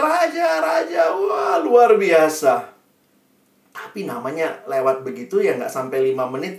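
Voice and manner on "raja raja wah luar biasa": high, loud acted cheers; crowd cheering. Villagers greet the passing king, jubilant cheering. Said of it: "semua orang sorak-sorai".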